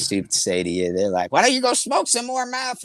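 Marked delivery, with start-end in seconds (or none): 1.3-2.8 high-pitched jeering taunt, imitating a heckler; mocking taunt. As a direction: sneer it like a troll picking a fight. Angry people taunting him online.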